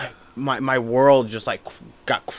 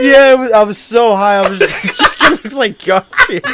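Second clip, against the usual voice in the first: high, loud, giggling retelling; talking through laughter; 6 semitones higher, 6 dB louder than usual. Real, not acted. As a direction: push the words out between laughs. Retelling while still cracking up.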